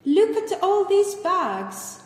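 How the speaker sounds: loud, high, drawn-out exclamation; amazed exclamation. Acted. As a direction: exclaim slowly over the shopper's haul. Greets shopper loaded with bags.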